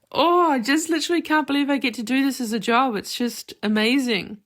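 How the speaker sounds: real excited gush; gushing delight. Real, not acted. Gushing about loving her job.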